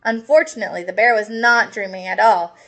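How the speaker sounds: ordinary delivery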